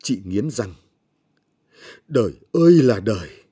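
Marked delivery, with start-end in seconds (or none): 2.1-3.4 loud wail to the heavens; a grieving mother's outcry, much louder; anguished lament. Acted. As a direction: cry it out in bitter despair. Mother's grief over dying child.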